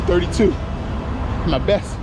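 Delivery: usual speaking voice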